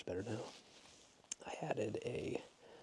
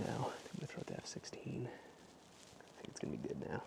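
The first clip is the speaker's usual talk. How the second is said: whispering; 8 dB quieter than usual. Real, not acted. Whispering near another photographer.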